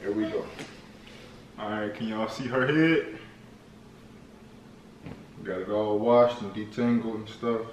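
hushed narration while working; quiet. Real, not acted. Dad speaks softly during styling.

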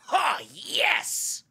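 mock ecstatic shout, very high-pitched; excited cheering. Comic imitating his friend cheering from four seats down, excited high cheer. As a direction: imitate an over-thrilled moviegoer whooping.

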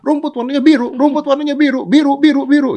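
high, loud repeated yelling; donkey yelling. Acted. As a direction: bray the chant nonstop. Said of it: "keledainya teriak-teriak". (keledai berteriak-teriak)

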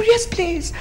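high, soft mouse voice; eager little mouse. Acted. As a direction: answer politely as a tiny mouse. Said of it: "He said".

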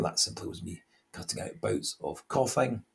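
usual speaking voice

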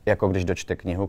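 ordinary delivery

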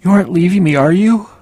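high loud desperate plea; pitiful plea. A narrator's reading. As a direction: beg not to be left. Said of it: "I must have sounded pitiful".

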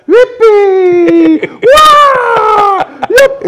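very high, loud whoops of joy; dad cheering every bump of the plane; dad whooping. Retold or imitated. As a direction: yell it like a kid on a roller coaster. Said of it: "papi hacía".

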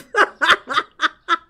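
loud high laugh. Real, not acted. Streamer laughing at a customer's open shirt. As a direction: burst out laughing.

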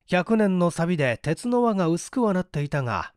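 ordinary delivery, nothing marked

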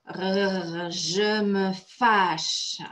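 growl, then slow drawn-out words; mock angry growl. Shown as a demonstration. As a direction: act cross with a rolled, growling lead-in. Acting out the anger vocabulary.

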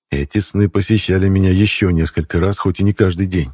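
plain reading voice

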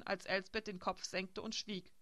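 ordinary delivery

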